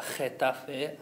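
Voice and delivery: "Getafe" echoed as kheh-TA-feh; "Getafe" with a Spanish jota opening; throaty G. Shown as a demonstration. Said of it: "that ge sound at the start".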